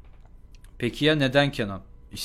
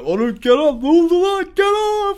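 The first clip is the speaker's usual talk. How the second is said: much higher, loud exaggerated alarm; over-the-top friend; 4 dB louder than usual. Friend Ahmet overreacting to Kenan, high and frantic. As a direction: ham up a panicky friend. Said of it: "inanılmaz aşırı tepkileri vardır".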